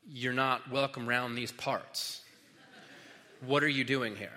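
a hint of a Western townsman; folksy frontier local. Demoniacs warning Jesus off, lightly cowboy-voiced. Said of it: "As they would say".

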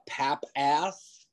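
"Pap Ass" split slowly into two words; mispronounced Pap Ass. Retold or imitated. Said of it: "you got, uh".

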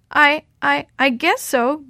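plain reading voice

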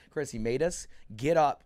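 usual speaking voice

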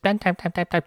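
high, rapid-fire mock chatter; imitated high-pitched yapping; mimicked chirping. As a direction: chirp it like incessant nagging. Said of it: "chirping the whole time".

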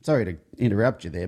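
ordinary talk

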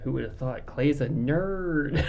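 slow, drawn-out tease; teasing. Real, not acted. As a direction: stretch it out mockingly. Teasing about player.

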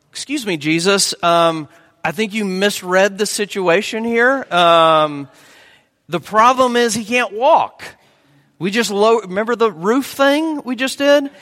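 mock-polite, rising to a loud near-shout; exasperated friends. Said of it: "can you imagine these friends ... going".

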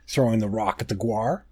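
plain reading voice

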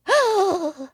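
sharp high gasp; crowd gasp. A narrator's reading. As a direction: gasp aloud like a startled crowd. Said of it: "The crowd gasped".

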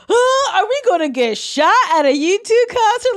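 high, loud panicked squeal; mock panic. Friend retells watching the other cuss out drivers, mock panic. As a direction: yelp it in exaggerated alarm.

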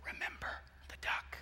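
hushed threatening whisper; sister's whispered reminder to her brother. Retold or imitated. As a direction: lean in and breathe it, no voice. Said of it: "she just leans over to him and she says".

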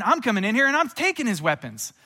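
rousing preacher's battle shout; forceful declaration. Acted. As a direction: charge in as Jesus on the offense. Acting Jesus seizing Satan's weapons.

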